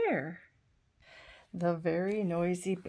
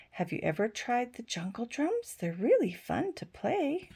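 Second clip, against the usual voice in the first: lively picture-book reading with voices for the animals; reading to children; 3 semitones higher, 4 dB louder than usual. A narrator's reading. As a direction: read it like a bedtime story for little ones.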